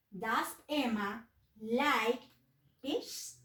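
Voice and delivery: very slow, word by word, spelling it out; slow model sentence. Shown as a demonstration. Teacher to young pupils, reading the model question very slowly. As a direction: read the example as if dictating.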